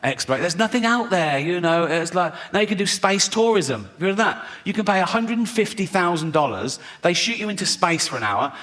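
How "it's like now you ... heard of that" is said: usual speaking voice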